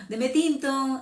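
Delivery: put-on Huila accent. Speaker imitating her Huila dialect ordering coffee. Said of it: "Así es el dialecto de allá".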